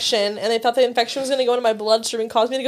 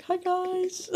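chirpy greeting; 8 semitones higher, 9 dB quieter than usual. Retold or imitated. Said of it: "I was like".

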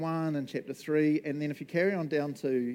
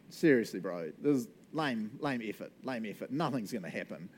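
unimpressed dismissal; 6 semitones lower than usual. Acted. Voicing an unimpressed reader.

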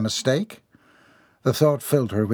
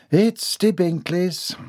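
slow, less thrilled announcement; unenthusiastic echo; 7 semitones higher, much slower than usual. A narrator's reading. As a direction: repeat the news slowly, with less glee. Same news repeated with less enthusiasm.

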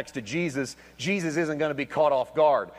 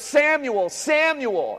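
raised call of the name; 7 semitones higher, much slower than usual. Acted. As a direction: wake the boy with the name. God waking a sleeping boy.

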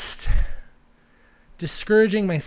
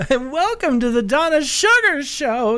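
campy drag queen; 11 semitones higher, 3 dB louder than usual. Pretend. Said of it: "this voice".

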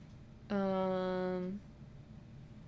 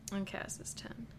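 whispering; 11 dB quieter than usual. Real, not acted. Quiet aside ending the ranking.

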